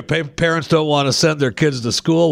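ordinary talk

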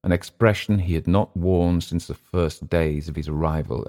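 usual speaking voice

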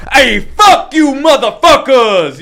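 hammed-up shout, very high and drawn out; overdone performance. Shown as a demonstration. Interpreter mocking an over-the-top colleague, hammed-up yell. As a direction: overact it, slow and blaring. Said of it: "that's that annoying fucking idiot".

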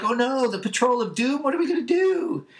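very high cartoon panic, climbing to a squeal; hammed-up frantic wail; freaking out. Beast Boy dreads the Patrol of Doom, mock hysterics. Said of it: "Beast Boy is freaking out".

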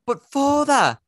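high, loud mock pleading son; shrill-free falsetto plea from a boy; Monty Python whine. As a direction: squeal it like a Python sketch son. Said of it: "I need to do my Monty Python thing".

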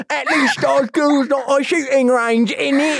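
high, laughing fake London voice; mock British meme voice. Retelling a meme video in mock London accent. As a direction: ham it up as a gnarly-toothed Brit. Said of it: "face flashes with gnarly teeth".

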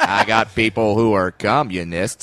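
low, slow cowboy movie-star drawl; John Wayne. Acted. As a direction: draw it out like an old western hero. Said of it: "when John Wayne gets upset".

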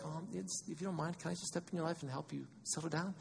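soft, timid, hesitant request; tentative and overly polite; mock apologetic. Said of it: "doesn't do it in an apologetic way".

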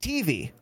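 "T V" with the T stressed and the V dropped. Retold or imitated. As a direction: punch the T in "T V". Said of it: "stress on the T".

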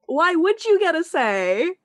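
high, loud, indignant challenge; incredulous retort. Real, not acted. Host answers an absent podcaster's demand, incredulous.

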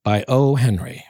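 measured, expressive reading voice; lively storybook narration; story narration. Acted. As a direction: read it like a classic tale by the fire. Reads O. Henry story aloud.

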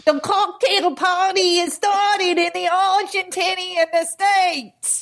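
high, monotone botched Kiwi accent; New Zealand accent. Shown as a demonstration. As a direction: badly fake a New Zealander on one high note. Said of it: "this is New Zealand. Ready?".

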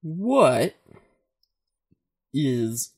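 slow, spaced-out stoner musing; lightly voiced dazed stoner. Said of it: "[name] can do his stoner voice".

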